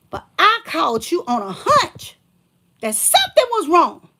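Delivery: rising indignant rant; indignant outburst. Real, not acted. Telling the ex off about the hospital, rising indignation. Angry recounting to ex.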